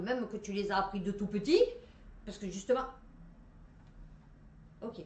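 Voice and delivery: ordinary talk, nothing marked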